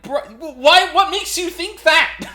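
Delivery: high, loud disbelief; baffled shout of why; incredulous. Real, not acted. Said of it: "incredulous outburst".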